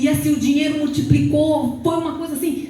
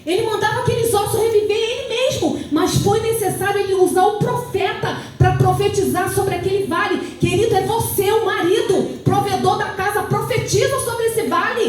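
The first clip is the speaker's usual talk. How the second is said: raised, insistent preacher's exhortation; impassioned church proclamation; fervent preaching; 6 semitones higher than usual. Real, not acted. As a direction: urge the congregation to believe, pitched high. Exhorts the congregation with fervour.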